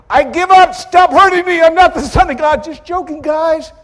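high, loud, pleading cry; imagined broken Jesus. Pretend. Soldiers imagine Jesus begging them, in a high pleading cry. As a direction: beg them to stop. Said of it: "waiting for him to say".

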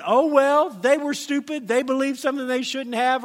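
high, loud dismissive voice; mocking people who shrug off unbelievers. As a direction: brush it off mockingly.

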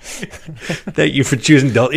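sweet airline PA greeting; flight attendant voice. Acted. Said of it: "you sound just like a flight attendant".

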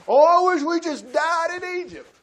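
high, loud mock whining; whiny Israelite. Preacher mocking grumbling Israelites with high, loud whining. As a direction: wail it like a self-pitying complainer.

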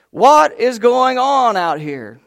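husband confronting wife over the mess, loud; exasperated shout. Retold or imitated. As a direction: demand an answer, fed up.

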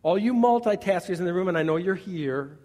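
ordinary delivery

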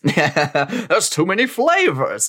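slow, overwhelmed frontier cowboy; old-timey cowboy voice. Pretend. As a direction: sound baffled like a frontier cowboy. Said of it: "be like".

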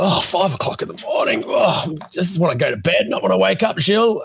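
high, whiny protest at being woken; groggy whine. Retold or imitated. Groaning at the early wake-up.

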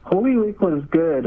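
usual speaking voice

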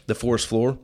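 ordinary delivery

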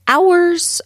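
"Hours" burst out high and loud; emphatic exclaim. Shown as a demonstration. Host exclaiming to listeners how long she could talk. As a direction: throw "Hours" out with gusto. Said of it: "repeats the word loud and high".

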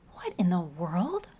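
unvoiced, slow whisper; girl whispering her astonishment; whispered thought. A narrator's reading. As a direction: breathe it to yourself. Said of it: "thought Peyton".

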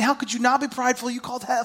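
ordinary talk, nothing marked

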